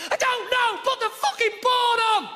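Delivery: high, loud fed-up yell; exasperated shout. Acted. Comic as a fed-up viewer shouting at ads, high loud snap. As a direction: snap back at the telly.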